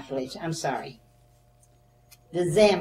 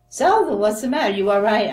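louder, alarmed calling out; worried yell; 4 semitones higher, 8 dB louder than usual. Retold or imitated. Mother calling out to daughter slumped at door, worried yell. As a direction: call to her daughter in a fright. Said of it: "She's yelling at me".

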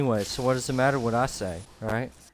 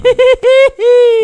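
loud burst of high laughter; 16 dB louder than usual. Real, not acted. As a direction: explode into laughter. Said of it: "it's laughing".

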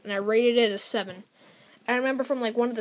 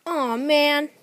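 higher, louder cartoon groan of dismay; mock dismay; 5 semitones higher, 6 dB louder than usual. Tomato Man losing the top of his head, mock dismay. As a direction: whine it like a thwarted villain. Comic villain's dismayed reaction.

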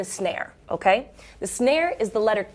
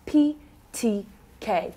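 P T K said slowly, each letter apart; teacher drilling the letters slowly; slow demo; slower than usual. Shown as a demonstration. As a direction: spell out P T K slowly. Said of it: "one more time".

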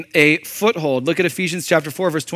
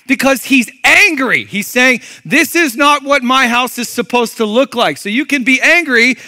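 much higher and louder, near shouting; fiery preaching; 9 semitones higher, 6 dB louder than usual. Real, not acted. Pastor tells church of Jesus's anger, shouting. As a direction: preach it at full force.

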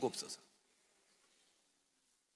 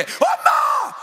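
very high, loud stagy cry for mom; fake mourning wail; 6 dB louder, much slower than usual. Shown as a demonstration. Daughter-in-law at mother-in-law's funeral, hammed-up wail. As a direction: overdo the grief like bad theatre. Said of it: "그런 걸 연극이라고 그럽니다".

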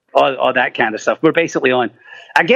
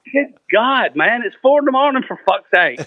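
higher, mock-exasperated complaint; exasperated at the hour, pitched up; exasperated friend; 9 semitones higher than usual. Friend woken at four, mock-exasperated. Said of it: "They're like".

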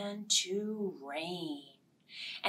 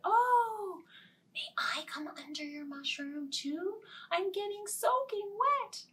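higher pleading bird; high sparrow; 10 semitones higher than usual. Acted. Sparrow asking to come under. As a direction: ask in a small high voice.

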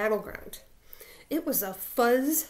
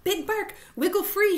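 high, raised calling voice; urgent shout; 9 semitones higher, 5 dB louder than usual. A narrator's reading. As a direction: call up to a friend in danger. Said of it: "shouted the prairie dog".

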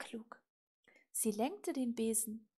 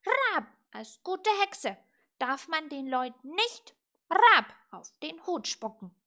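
loud, high croaky lecture; reproachful raven; 6 semitones higher, 13 dB louder than usual. A narrator's reading. Raven teaching little witch manners, reproachful. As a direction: scold between squawked caws. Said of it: "sagte er tadelnd".

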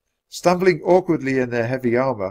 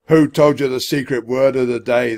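lightly voiced stern centurion, louder; demanding; 2 semitones higher, 6 dB louder than usual. A narrator's reading. Centurion interrogating the children, demanding. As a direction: demand an answer with some force. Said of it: "the centurion demanded".

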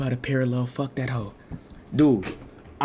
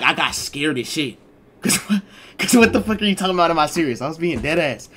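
usual speaking voice

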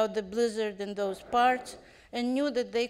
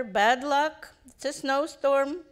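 shouting; 4 semitones higher, 6 dB louder, slower than usual. A narrator's reading. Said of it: "shouted the driver".

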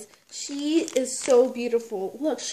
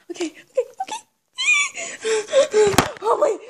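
ordinary talk

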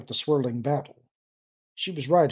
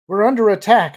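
urgent, raised and tense; tense alarm in a raised voice; 7 semitones higher, 11 dB louder than usual. A narrator's reading. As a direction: report it under pressure, louder and higher. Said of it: "her voice tense".

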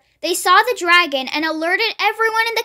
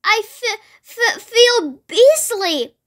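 high, halting, drawn-out complaint; distressed storybook dragon; stammering dragon; 5 semitones higher, slower than usual. A narrator's reading. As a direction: stutter on the first sound, then stretch the words.

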